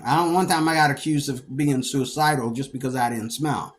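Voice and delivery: ordinary talk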